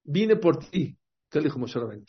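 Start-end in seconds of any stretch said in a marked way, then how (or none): 0.0-1.0 grito teatral más agudo; llámalo desde lejos, exagerado; grito fingido. Acted. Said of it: "Le gritó". (grito teatral desde lejos)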